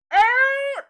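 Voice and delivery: loud, high-pitched halting yell; sudden shout. Retold or imitated. Store girl halting a man walking by, sudden high shout. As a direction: shout to stop someone in their tracks. Girl stops them, calling out.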